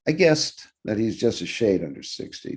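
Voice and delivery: ordinary delivery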